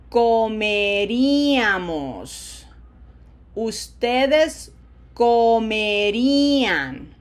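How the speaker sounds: drawn-out Spanish drill, loud and clear; very slow modelling. Shown as a demonstration. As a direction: project it and hold each vowel long. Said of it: "that's why I go so slowly".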